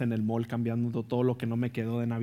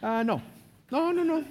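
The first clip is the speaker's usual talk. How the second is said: startled refusal. Retold or imitated. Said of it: "Y dije".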